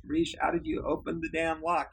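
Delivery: exasperated and half laughing; exasperated demand. Retold or imitated. Boss fed up with intern's answers, exasperated demand. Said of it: "Now I am killing him at this point".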